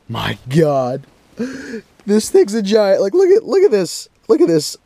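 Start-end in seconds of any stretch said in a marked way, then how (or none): 2.1-4.8 high-pitched, giddy marvelling. Real, not acted. Angler showing viewers the giant fish, giddy as a kid. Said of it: "I'm a kid at Christmas".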